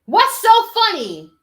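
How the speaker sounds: slow, loud, high and indignant; indignant demand. Real, not acted. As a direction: stretch it out, demanding an answer. Indignant at the laughing interviewer.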